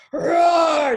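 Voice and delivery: loud, high full roar; lion's roar. Acted. Lion teaching the cub to speak, big roar. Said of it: "this is how a lion sa".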